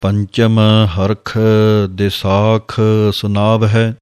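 slow, sung-through recitation of gurbani; scripture recitation. Shown as a demonstration. Teacher recites the verses slowly for students. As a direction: chant the verse slowly and evenly.

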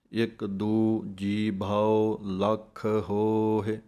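slow, measured chant of scripture, each word drawn out; slow Gurbani recitation. Shown as a demonstration. Teacher recites the verse for students, slow chant.

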